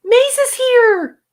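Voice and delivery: high, loud, drawn-out cheer; thrilled shout of welcome; excited announcement. Real, not acted. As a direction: sing out a friend's arrival with delight. Announces a friend's arrival in chat.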